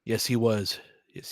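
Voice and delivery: normal talking voice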